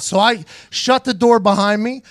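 normal talking voice

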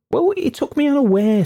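rattled waiter, voice shot up high; defensive waiter. Acted. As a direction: squeak out a flustered excuse. Waiter defends his fright.

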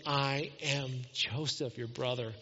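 a hushed, gentle I am Joseph. Acted. Joseph gently telling his brothers who he is, softly. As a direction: reveal yourself softly to family. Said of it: "And he said again".

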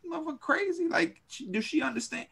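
high, hushed disbelief; incredulous aside. Retold or imitated. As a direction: ask your friend if she's lost it, under your breath. Baffled aside to his friend.

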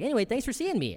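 a mocking Indian accent, as the auditioning actor. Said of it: "you're doing an accent".

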